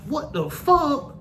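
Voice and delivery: high slowed-down freakout; stunned disbelief. Retold or imitated. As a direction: drag it out way up high. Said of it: "I'm like".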